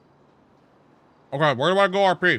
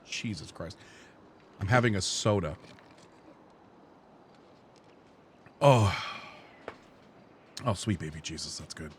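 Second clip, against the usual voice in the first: blissful sigh; 11 semitones lower, 8 dB quieter than usual. Real, not acted. First soda in months.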